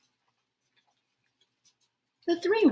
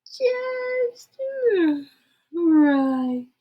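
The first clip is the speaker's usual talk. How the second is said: yawned; 9 semitones higher, 5 dB louder than usual. Acted. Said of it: "yawned Goldilocks".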